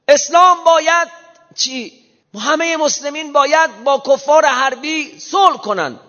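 hammed-up caricature of a man selling a shocking new claim; mocking a novelty-seeker.

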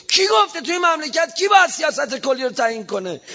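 indignant shout. Acted. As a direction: hurl the question at the hall. Indignant rhetorical question to congregation. (فریاد معترضانه و زیر)

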